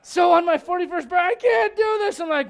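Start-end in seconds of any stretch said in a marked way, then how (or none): 1.3-2.2 high, loud mock-distraught cry; imitated wailing. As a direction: imitate a panicked wife wailing. Imitating the crying wife again.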